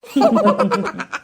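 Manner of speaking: loud high laugh; burst of laughter. Acted. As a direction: laugh out at the joke. Teasing friends burst out laughing. (உரக்கச் சிரிப்பு)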